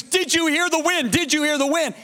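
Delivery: high-pitched excited retelling of her cry; high, urgent, half-strength scream; mild mock scream. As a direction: imitate her frantic question, high but not shouted. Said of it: "she screams".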